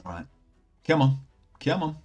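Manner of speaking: ordinary talk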